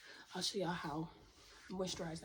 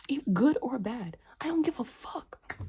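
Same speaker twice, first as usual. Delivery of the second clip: loud, slow, emphatic defiance; defiant outburst; 4 semitones higher, 10 dB louder than usual. Real, not acted. Dismissing what others feel about her, loud defiance. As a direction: stretch it out loudly and emphatically.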